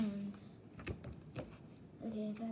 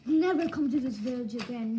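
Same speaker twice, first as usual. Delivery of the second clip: loud, forceful retold order; loud mock outrage; mock angry banishment; 7 dB louder than usual. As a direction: act out angry villagers driving someone off. Villagers banish someone in retold story.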